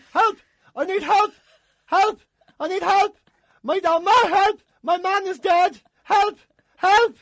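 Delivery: high, loud yelping cries for help; panicking dog. Acted. Dog at the window thinks owner is dead, mock-panicked yelling. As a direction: shout in short frantic bursts, like a hammed-up dog.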